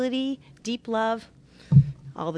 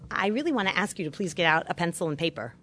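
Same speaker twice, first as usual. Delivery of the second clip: ordinary talk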